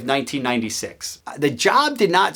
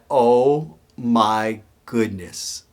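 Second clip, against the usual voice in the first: low, very drawn-out disbelief; stunned; 6 semitones lower, much slower than usual. Retold or imitated. New hire reacting to the boss's prank, retold slow stunned disbelief. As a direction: stretch each word out low, acting stunned.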